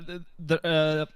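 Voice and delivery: ordinary talk, nothing marked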